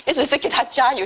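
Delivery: ordinary delivery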